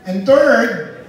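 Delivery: preacher hammering out numbered points; forceful preaching. Acted. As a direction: drive each point home to the church.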